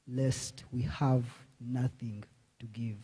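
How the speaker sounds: ordinary talk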